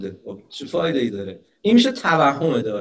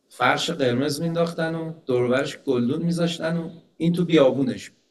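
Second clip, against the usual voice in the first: ordinary talk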